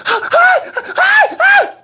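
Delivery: very high panicked yelps; scared cries. Retold or imitated. Scared cellmate banging on the cell, high panicked cries. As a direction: imitate frantic, gasping cries while banging. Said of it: "The dude was scared as hell".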